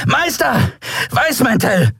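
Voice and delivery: high shouted warning from below; shouting a warning. Acted. Lan shouts up to his master, alarmed, very high. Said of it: "von unten rufen".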